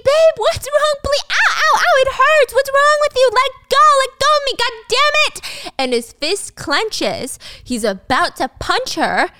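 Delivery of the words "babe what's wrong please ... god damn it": high, loud, yelping pained cries; screaming in pain. Retold or imitated. Michael screaming at his wife to let go, high and loud. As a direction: yelp and plead as she drags you down. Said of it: "He's screaming".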